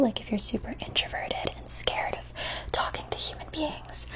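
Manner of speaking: soft ASMR whisper. Acted. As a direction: whisper close to the mic.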